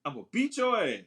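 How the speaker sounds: high-pitched mock parental warning; scolding parent. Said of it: "he like a parent".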